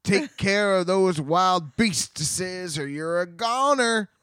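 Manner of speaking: high, loud, drawn-out rustic holler; uncle's warning shout. Acted. Uncle Henry warning Aunt Em about wild beasts, hammed-up shout.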